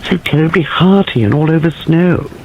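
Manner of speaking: high eager stage whisper; high penetrating whisper. A narrator's reading. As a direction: gush about the newcomer excitedly. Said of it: "high, penetrating whisper".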